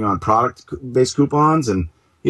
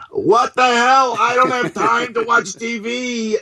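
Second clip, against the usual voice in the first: high, dragged-out mock mom complaining about the app; imitating his mom; 13 semitones higher than usual. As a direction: play the bewildered mother griping down the phone. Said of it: "my mom calling up going".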